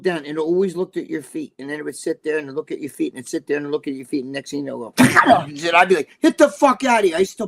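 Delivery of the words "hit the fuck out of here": high, loud shout at a dog; retold yell at the Rottweiler; mock yell at dog. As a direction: yell the dog away, half-joking. Yelling at the biting dog.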